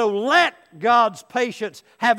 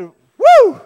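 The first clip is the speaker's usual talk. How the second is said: loud high "woo" on surfacing; acted gasp after a dunking; gasping whoop; 17 dB louder than usual. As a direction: burst out a high whoop for air. Man comes up gasping from underwater.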